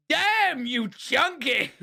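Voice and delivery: high, stretched-out mock shock; playful outburst at a chubby pet; teasing amazement. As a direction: exclaim it high, dragging out the words. Teasing his chubby bearded dragon.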